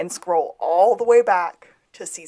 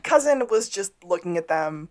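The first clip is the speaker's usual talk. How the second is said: usual speaking voice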